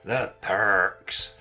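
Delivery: put-on broad rural Wiltshire accent on "The perks". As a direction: drawl "The perks" in a West Country Wiltshire burr. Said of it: "Proper Wiltshire accent there".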